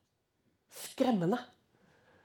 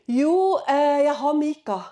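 mock king reply; 3 semitones higher, 9 dB louder, slower than usual. Ahab answers Josafat.

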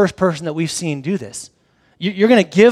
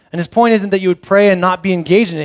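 ordinary talk, nothing marked

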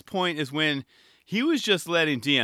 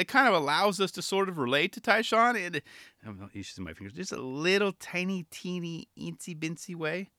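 ordinary talk, nothing marked

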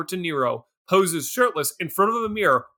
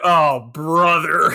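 slow, drawn-out mock dismay; hammed-up long-suffering reaction; exasperated groan; 3 semitones lower, 4 dB louder, much slower than usual. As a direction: stretch it out in weary disbelief. Acts out own groaning reaction.

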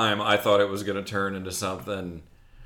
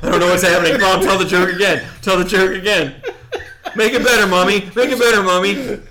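loud, high pleading to Mommy; clawing the desk, begging Mommy, loud whiny kid; desperate child; 6 semitones higher, 5 dB louder, faster than usual. Retold or imitated. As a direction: beg like a frantic little kid. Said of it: "you're clawing the desk".

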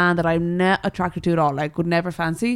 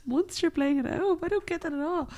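higher and softer, incredulous; baffled; 10 semitones higher, 8 dB quieter than usual. Real, not acted. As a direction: puzzle over the words in disbelief. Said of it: "What the fuck?".

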